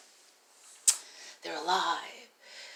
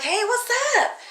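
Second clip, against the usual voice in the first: put-on much higher, bright little-girl hello; eager greeting; 6 dB louder than usual. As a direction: chirp hello like an eager kid. Child greeting serious aliens.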